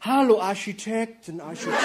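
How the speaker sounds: camp flirt call. Acted.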